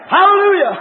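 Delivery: high, loud hallelujah; real revival-preacher shout; exultant praise shout. Real, not acted. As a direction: cry out praise to the congregation.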